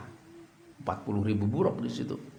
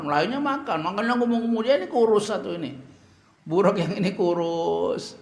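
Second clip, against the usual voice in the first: high, loud and fast; excited exclaiming; 9 semitones higher, 4 dB louder than usual. Real, not acted. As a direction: marvel at the thin one. Preacher exclaims about thin burak.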